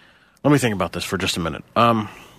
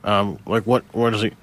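ordinary talk, nothing marked

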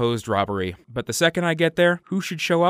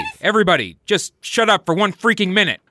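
raised, higher exasperated voice; 7 semitones higher, 4 dB louder, faster than usual. Acted. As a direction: cut through the crowd, fed up. Hero silencing mobbing fans.

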